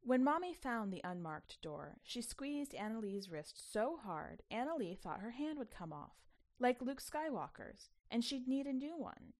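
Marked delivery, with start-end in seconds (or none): none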